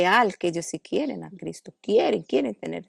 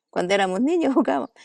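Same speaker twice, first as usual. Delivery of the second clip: repeats it with a real laugh breaking in, a bit higher and quicker; 5 semitones higher, faster than usual. Real, not acted. Telling the interviewer about childhood games, laughing. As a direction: laugh through the happy memory.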